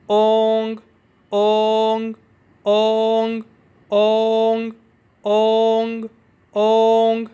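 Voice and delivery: اونگ loud, long o into nasal ng, faint g; ong sound. Shown as a demonstration. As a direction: hold اونگ loudly and barely touch the g. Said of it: "او این جی کی pronunciation ہے". (اونگ، لمبی او اور ہلکا گاف)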